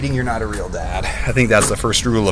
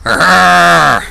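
zombie growl; 5 semitones higher, 6 dB louder than usual. Pretend. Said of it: "I made a noise".

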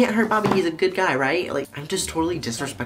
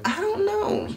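drawn-out squeaky mock answer; high helpless whine. Answering why it is stressing them out, high helpless whine. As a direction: send it way up high and stretch it.

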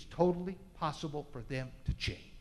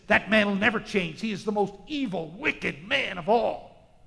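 scornful crowd; 4 semitones higher, 9 dB louder than usual. Acted. Crowd condemning Zacchaeus.

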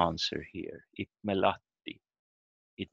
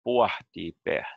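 "Pohti, behtet" strongest on "Poh", lighter on "beh"; stronger initial stress; 3 dB louder than usual. Shown as a demonstration. Teacher repeats for learners, "Pohti, behtet" strongest at the start. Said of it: "more stronger in the start".

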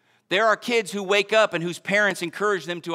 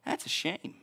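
whispered shrug; 4 semitones lower, 13 dB quieter than usual. Retold or imitated. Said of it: "And you go".

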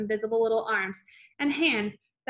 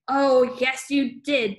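loud roar back; 6 dB louder, much slower than usual. A narrator's reading. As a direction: bellow it right back at her. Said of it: "the Trunchbull roared back".

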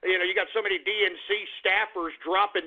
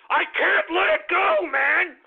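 can't let it go; 7 semitones higher, slower than usual. Acted. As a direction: let the anger boil over. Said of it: "I'm just so goddamn angry".

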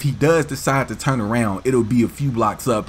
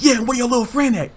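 higher mock tough-guy voice; angry gangbanger; 12 semitones higher than usual. Angry driver to the caught kid, higher mock taunt.

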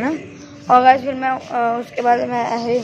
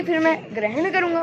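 a hint of high-flown pure Hindi, slightly higher; lightly mock-formal shuddh Hindi phrasing; 6 semitones higher than usual. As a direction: say ग्रहण like a mock-pompous scholar. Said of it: "मैंने कुछ ज़्यादा ही शुद्ध हिंदी बोल दी".

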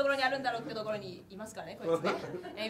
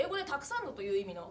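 normal talking voice

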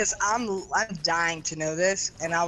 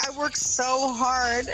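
mock whine; 7 semitones higher, slower than usual. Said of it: "I'm like".

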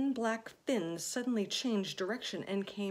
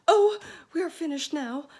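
high, loud wail; despairing cry; 10 semitones higher, 15 dB louder than usual. A narrator's reading. Miss Spider crying out in despair. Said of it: "cried Miss Spider".

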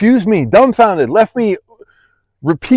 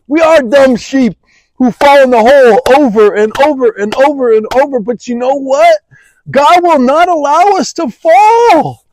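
raised, insistent preaching; impassioned preaching; 4 semitones higher than usual. Acted. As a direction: drive it home to the congregation. Preaching climax.

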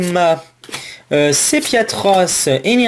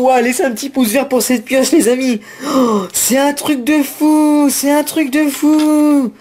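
excited gushing; 5 semitones higher than usual. Real, not acted. Still reacting to the best pull.

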